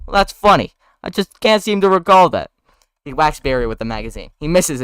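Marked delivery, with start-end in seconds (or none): 0.1-2.5 mocking. Barry mocking Ken.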